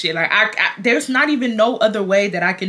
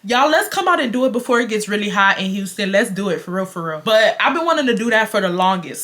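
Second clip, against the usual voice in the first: ordinary talk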